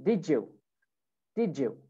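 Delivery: "did you" run together as "didjoo"; "did you" with a J in the middle; linked J sound. Shown as a demonstration. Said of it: "with a J sound".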